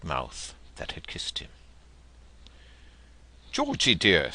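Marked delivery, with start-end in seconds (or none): 3.5-4.3 mildly surprised, raised call of his name; a touch of astonishment; mother startled by her son's stare, lightly amazed. Acted. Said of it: "said the mother amazedly".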